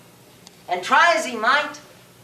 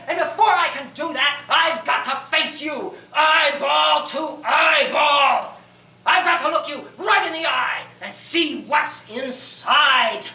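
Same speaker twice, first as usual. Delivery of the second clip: impassioned declaration; 3 semitones higher than usual. Acted. Knight vowing to face the dragon eyeball to eyeball, impassioned. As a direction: declaim it with desperate resolve, drawing out key words.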